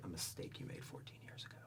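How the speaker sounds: hushed, breathless whisper; menacing whisper. Retold or imitated. Son confronts his biological father, whispered. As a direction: whisper it like a ghost from his past. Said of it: "I'm like".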